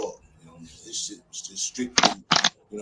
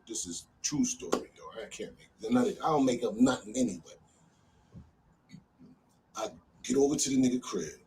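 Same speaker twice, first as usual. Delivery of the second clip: normal talking voice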